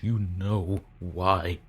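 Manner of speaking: each word dragged out slow and heavy; drawn-out menacing accusation; slow menacing repeat. Acted. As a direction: lean on it, slow and threatening. Hostile message read out.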